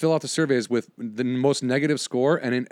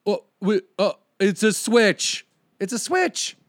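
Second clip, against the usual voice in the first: higher, slow, stammering admission; mocked flustered agent; 6 semitones higher, slower than usual. Agent caught in a lie, flustered mock voice.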